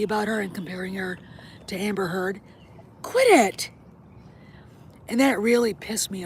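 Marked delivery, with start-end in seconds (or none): 3.0-3.7 high, loud command; sharp pet scolding. Real, not acted. Owner interrupting the talk to check a pet, sharp scolding. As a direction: cut in loudly to stop the dog.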